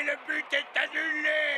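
high, slow cry of disbelief; dismayed cry. Acted. Coach crying out as the goal is cancelled. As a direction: wail it out in disbelief.

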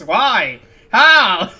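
loud, high cry of disbelief; mock anguished outcry. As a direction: wail it dramatically, hamming it up. Mock outcry reading game text.

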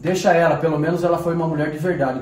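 loud, heated insistence; angry defence. Acted. Man defending his carer to his girlfriend, angry defence. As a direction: defend her forcefully over objections.

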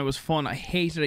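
ordinary talk, nothing marked

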